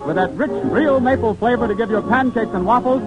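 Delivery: bright, polished 1930s sponsor read; old radio announcer. Acted. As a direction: sell the syrup like a vintage radio ad.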